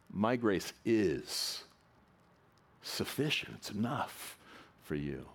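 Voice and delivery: slow "is", a long pause, then "sufficient" set apart; stress on IS, then SUFFICIENT. Shown as a demonstration. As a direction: land on "is", wait, then lift "sufficient". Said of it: "one word at a time and emphasize it".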